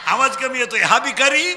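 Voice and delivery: loud, projected rallying of a crowd; call-and-response preaching. Real, not acted. Preacher drilling children for loud answers, call-and-response preaching. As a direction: throw each phrase out for the crowd to finish.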